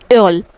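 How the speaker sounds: एल said with a rounded ö; German ö word. Shown as a demonstration. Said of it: "क्या बोलेंगे?". (German "एल" गोल ओठों वाले ö के साथ)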